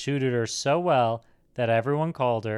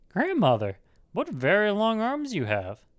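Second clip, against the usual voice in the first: put-on high-pitched girlish amazement; surprised little girl; 9 semitones higher than usual. A narrator's reading. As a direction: marvel in a much higher girl's voice. Surprised girl questions disguised wolf.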